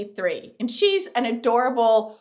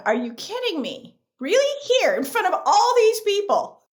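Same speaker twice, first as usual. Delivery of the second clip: disbelief; 9 semitones higher than usual. Retold or imitated. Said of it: "you're just absolutely going".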